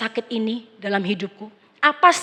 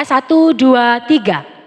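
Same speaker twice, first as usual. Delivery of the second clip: counting in; 7 dB louder, slower than usual. Shown as a demonstration. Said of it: "Sama-sama aja ya".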